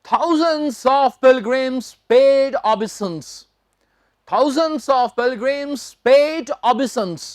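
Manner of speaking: slow headline reading. Shown as a demonstration. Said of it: "अगली headline देखिए".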